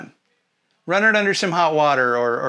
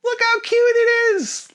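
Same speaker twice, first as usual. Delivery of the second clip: cutesy high voice; 3 dB louder, slower than usual. Acted. Gushing over cute game.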